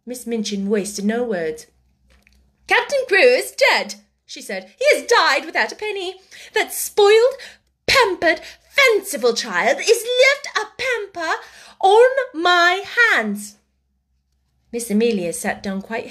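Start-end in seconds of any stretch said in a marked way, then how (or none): 2.7-4.0 high, loud, blunt; irate raised voice; angry announcement. A narrator's reading. Angry sister breaking bad news. 4.8-13.6 high, loud, spat-out scorn; bitter outrage, pitched up; furious rant. A narrator's reading. Furious woman ranting about child.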